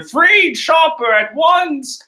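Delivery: very high, loud, drawn-out demand; Brook yelling. Acted. Skeleton demanding his crewmate's release, high-pitched yell. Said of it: "Brook is yelling".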